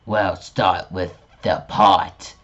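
ordinary talk

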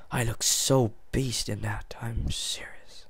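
whisper. Real, not acted. Mock-serious aside.

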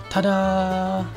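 bright, high ta-da; ta-da reveal. Real, not acted. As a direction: present it like a magic trick. Device lights up.

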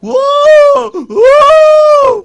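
huge, high, loud mock crying howl; hammed-up wail. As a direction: howl in fake grief. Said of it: "ఏడుస్తున్నాడా?".

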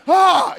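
high shocked yelp; startled loud gasp. Retold or imitated. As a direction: let out a loud startled cry. Reacting to Monty's shout.

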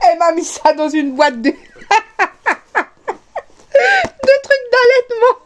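high, squeaky words through laughter; barely getting it out for laughing; real laughing fit. Real, not acted. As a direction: crack up helplessly mid-sentence, pitch climbing. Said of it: "Le fou rire de ce soir".

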